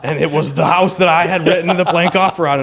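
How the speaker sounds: laughing loud at the irony. Real, not acted. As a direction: crack up while telling it.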